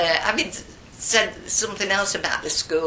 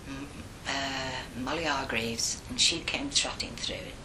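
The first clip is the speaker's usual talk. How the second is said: ordinary talk, nothing marked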